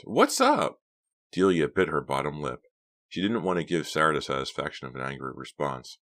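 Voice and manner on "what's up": grinning. Acted. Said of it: "Sarah grinned".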